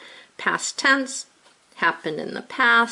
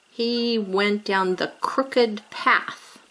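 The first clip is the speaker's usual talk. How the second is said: "crooked" stressed, whole sentence said slowly; slow example sentence; 2 semitones higher, much slower than usual. Shown as a demonstration. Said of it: "That's a describing word right there".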